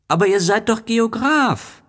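put-on very high, insistent child protest; exasperated little prince. A narrator's reading. Little prince insists to the geographer, very high and exasperated. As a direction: press the word doch in disbelief.

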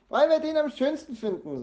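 put-on very high, loud child's cry; shrieked, excited protest; calling out. A narrator's reading. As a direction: shout it out insistently. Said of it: "ruft Jana".

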